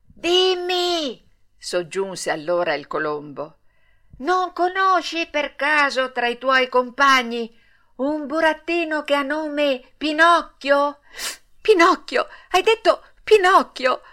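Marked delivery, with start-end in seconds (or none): 0.0-1.3 high, long-held hail; drawn-out high-pitched call. A narrator's reading. Pigeon calls down again. 4.2-11.0 raised, high-pitched calling question; sustained high call; calling from above. A narrator's reading. Pigeon calls down from high up. 11.6-14.0 high, loud astonished question; excited high-pitched puppet-child surprise; startled disbelief. A narrator's reading. Puppet jumps up hearing his name.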